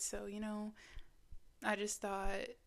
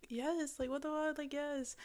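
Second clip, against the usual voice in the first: higher, bright little cheer for a friend; excited cheering; 6 semitones higher than usual. Pretend. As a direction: hype them up, pitch rising. Cheering on someone else.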